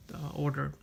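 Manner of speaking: ordinary delivery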